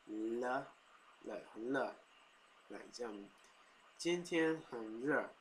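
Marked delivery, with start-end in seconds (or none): 0.1-1.9 "le" for re, light l sound; Taiwanese "le". Shown as a demonstration. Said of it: "which kinda be like". 3.6-5.3 slow, higher Taiwanese-accented "今天很热"; thick Taiwanese accent. Shown as a demonstration. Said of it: "a thick Taiwanese accent".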